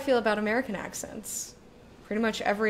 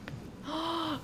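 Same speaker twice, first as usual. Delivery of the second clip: high, soft gasp; sharp intake of delight; excited gasp; 7 semitones higher, 8 dB quieter than usual. Real, not acted. Hears of listener's Scottish husband.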